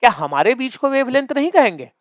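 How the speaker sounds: high-pitched mock whine; mock tearful. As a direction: imitate a student starting to cry. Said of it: "फिर ये रोने लग गया".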